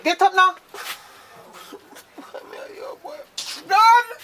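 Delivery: high loud angry yell. Acted. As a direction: yell at the lazy son. Mother yelling at son.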